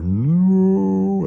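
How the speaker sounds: long, loud, high-pitched anguished cry; hammed-up movie scream of despair; Darth Vader. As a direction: draw out one huge wailing no. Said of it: "doesn't sound like Darth Vader".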